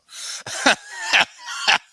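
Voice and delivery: loud high laugh; high burst of laughter. Real, not acted. Laughs at a comment.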